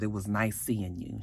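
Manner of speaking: quiet, tender goodbye exchange; soft parting words. Retold or imitated. Recapper voices ex-lovers parting softly at the cafe. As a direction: say it softly, lingering. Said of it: "He said".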